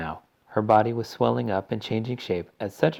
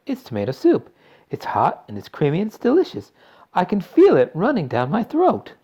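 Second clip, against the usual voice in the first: loud, higher delight; excited shout; 10 semitones higher, 6 dB louder than usual. A narrator's reading. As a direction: marvel at every mouthful. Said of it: "shouted Violet".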